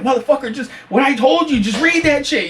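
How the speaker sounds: high-pitched, fed-up acted order; arguing back. Director to narrator in the sound booth, acted exasperation. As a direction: re-enact losing patience in the studio. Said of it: "we're going back and forth".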